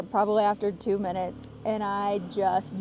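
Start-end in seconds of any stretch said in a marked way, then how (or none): 1.6-2.8 retching sound. Retold or imitated. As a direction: imitate a big heave. Mimics retching at roadside.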